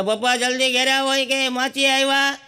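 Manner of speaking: put-on a kid hurrying his dad home; son on the phone.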